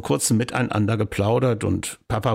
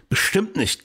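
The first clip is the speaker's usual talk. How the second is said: panting; 8 semitones higher than usual. Acted. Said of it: "keuchte Petala".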